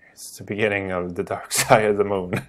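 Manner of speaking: fast unvoiced whisper with a laugh; quick amused whisper. Real, not acted. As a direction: whisper it as a private joke. Whispered aside.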